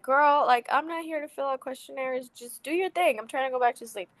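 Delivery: mildly exasperated, pitch lifted in protest. Retold or imitated. Tired patient silently griping at her new doctor, exasperated. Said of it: "I'm even more frustrated".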